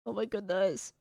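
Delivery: barely holding back tears; choked up. Real, not acted. Overwhelmed by emotional message.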